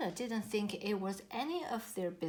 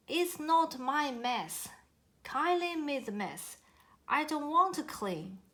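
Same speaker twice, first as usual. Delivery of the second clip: put-on a childish huff, pushing the blame; mock arguing; 6 semitones higher, 4 dB louder than usual. A narrator's reading. Koala quarrels with its treehouse mates, sulky and childish. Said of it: "They began to argue".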